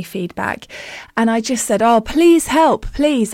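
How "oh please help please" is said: higher, drawn-out plea for help; imitated desperate appeal, pitched up; retold pleading. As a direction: beg the teacher, re-enacting it.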